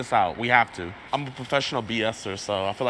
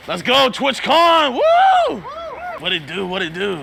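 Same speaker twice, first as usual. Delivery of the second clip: high, loud, slow disguise shout; fake voice; 13 semitones higher, 7 dB louder, slower than usual. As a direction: yell it in a goofy fake voice. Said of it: "That's gonna be my fake voice in there".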